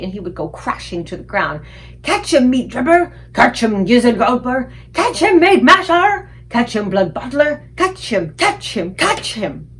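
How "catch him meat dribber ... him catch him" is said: loud, high giant calls, fading at the end; rowdy giant shouts. A narrator's reading. Giants playing ball with the BFG, shouting. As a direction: bellow each name as you throw.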